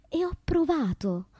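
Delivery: ordinary delivery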